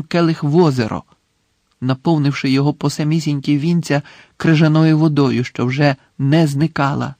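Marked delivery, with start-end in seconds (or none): none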